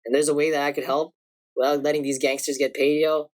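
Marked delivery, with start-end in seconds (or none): none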